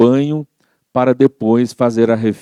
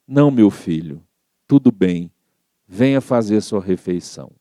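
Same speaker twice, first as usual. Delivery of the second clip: gentle mother; 3 semitones lower than usual. Acted. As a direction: soothe an angry son. Mother forgives son gently. (mãe serena e carinhosa)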